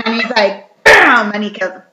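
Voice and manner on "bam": loud bam. Acted. Leprechaun striking.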